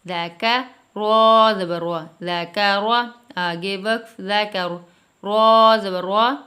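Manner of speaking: loud, slow syllable-by-syllable spelling chant; slow spelling drill. Shown as a demonstration. Teacher spelling out a Qaida lesson, slow loud drill. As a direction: drill each letter and vowel mark slowly for the child. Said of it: "ٹھیک ہے، اس طریقے سے۔".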